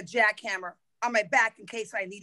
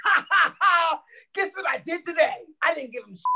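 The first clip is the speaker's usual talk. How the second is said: gleeful laughing boast; laughing gloat; 4 semitones higher than usual. Retold or imitated. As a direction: cackle while bragging about the trick. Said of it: "And then he's like".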